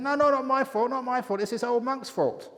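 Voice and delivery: very high, loud frantic denial; husband desperately shifting blame to the monk, shrill-high and loud; panicked husband. Acted. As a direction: squeal the blame away in panic.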